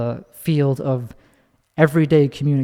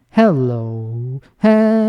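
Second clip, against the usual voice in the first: octave swoop hello; much slower than usual. Shown as a demonstration. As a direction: swing "hello" through almost an octave. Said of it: "pitched it down almost an octave".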